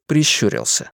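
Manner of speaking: ordinary delivery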